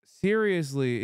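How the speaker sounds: ordinary delivery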